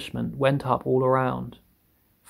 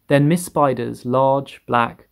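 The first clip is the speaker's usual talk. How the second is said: usual speaking voice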